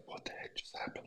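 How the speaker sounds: breathless whisper, no voice at all; shocked whisper. Retold or imitated. Retelling his shock after the session, whispered. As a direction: hush it like you can't believe it.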